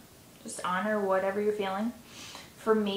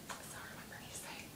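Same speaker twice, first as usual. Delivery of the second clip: whispered aside; 21 dB quieter than usual. Real, not acted. Hushed aside to listeners.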